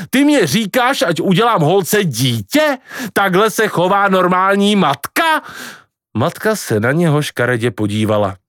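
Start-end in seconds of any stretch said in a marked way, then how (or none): 0.1-5.4 high-pitched indignant questioning; shrieking outrage. Acted. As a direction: fire the questions up high at mother, appalled. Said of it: "ječel Kvido". (pobouřené ječení na matku)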